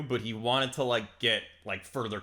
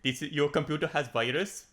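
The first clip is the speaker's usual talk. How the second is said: hammed-up Indian call center accent; lightly put-on Indian accent, scam caller; Indian tech support scammer; 4 semitones higher than usual. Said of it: "reads like an Indian tech support scam".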